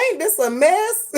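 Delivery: high, drawn-out imitation of Sierra; lightly voiced Sierra, lamenting from bed. Hungover in bed, imitating Sierra's lament, high and drawn out. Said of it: "I was sounding like Sierra in my bed".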